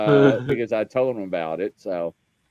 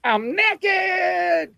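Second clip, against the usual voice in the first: much higher, squeaky mock blurt of "naked"; startled blurt. Retelling blurting it out to the doctor, squeaky much higher blurt. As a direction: squeak it out in flustered panic. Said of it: "And I was like".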